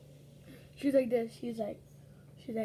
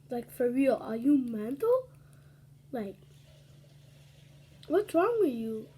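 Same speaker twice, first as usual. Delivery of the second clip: indignant outburst; 7 dB louder, slower than usual. Retold or imitated. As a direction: demand to know what's wrong with her, fed up. Narrator's angry retort retold.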